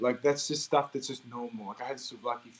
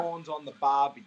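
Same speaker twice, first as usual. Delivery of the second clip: native Australian model line, lilting Barbie; Australian accent demo. Shown as a demonstration. Australian guest models the phrase for an American host in a real Australian accent. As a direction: say it the Aussie way for her to copy. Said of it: "can you say".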